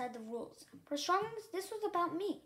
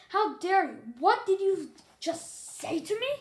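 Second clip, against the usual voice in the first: louder, indignant demand; outraged; 5 semitones higher, 8 dB louder than usual. A narrator's reading. Mauser outraged at the insolent young dragon, loud and indignant. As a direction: hurl the outrage back at her.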